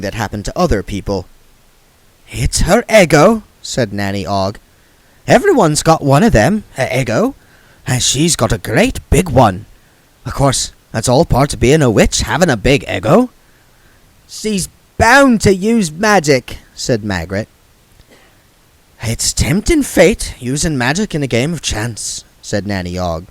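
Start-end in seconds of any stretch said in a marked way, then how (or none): 2.3-3.4 put-on lightly raised, chatty old woman; a hint of gossipy old witch; Nanny Ogg's voice. 5.3-13.3 put-on lightly raised, chatty old woman; a hint of gossipy old witch; Nanny Ogg's voice. 14.3-16.6 high, lightly voiced young woman; Margaret's voice. 19.0-22.2 put-on lightly raised, chatty old woman; a hint of gossipy old witch; Nanny Ogg's voice.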